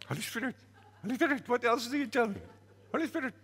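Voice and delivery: high, loud mocking woman's voice; a jeering woman, pitched high and loud. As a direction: taunt him in a high, loud voice. Woman mocking his prophecy.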